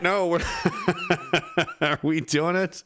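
breaking into real laughter mid-sentence; laughing. Real, not acted. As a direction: crack up halfway through the question. Cracking up after the joke.

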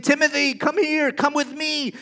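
high, loud stranger's call. Pretend. Stranger calling loudly to someone else's child. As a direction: lure the child over from afar.

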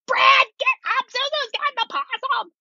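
high panicky call upstairs. Retold or imitated. To husband upstairs, a high panicky call. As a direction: sound alarmed, calling your husband awake. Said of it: "my panicky voice".

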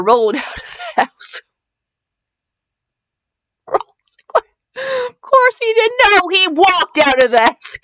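real helpless laughter through the words; laughing hard. Real, not acted. Breaks up laughing at the story.

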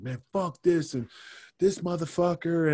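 retold cursing. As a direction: imitate a man griping in curses. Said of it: "cursing, man".

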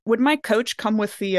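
usual speaking voice

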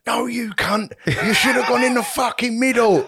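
high, loud yelling fan with a sung edge; hammed-up armchair fan shout; mock telly shouting. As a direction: bellow at the screen like a sofa pundit.

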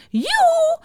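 piercing witch's call; shrill. A narrator's reading. Witch calls from behind their backs, shrill. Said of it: "riep een schelle stem".

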